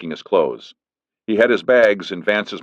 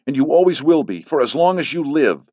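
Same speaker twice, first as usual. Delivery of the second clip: threatening; 9 semitones higher, 3 dB louder than usual. Acted. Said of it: "she added threateningly".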